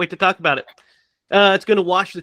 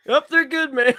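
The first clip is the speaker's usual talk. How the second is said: higher, through laughter; laughing reply; 7 semitones higher than usual. Retold or imitated. Checking brake lights for brother, laughing. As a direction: chuckle it out.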